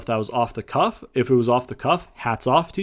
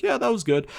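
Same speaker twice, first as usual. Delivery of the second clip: unimpressed shrug; 9 semitones higher than usual. Retold or imitated. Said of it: "you're gonna be like".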